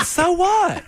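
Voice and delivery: So what thrown out high and defiant; a high, hammed-up sheriff hopeful; high mock defiance. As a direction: fling it back in a high squeal. Parody cop, defiant.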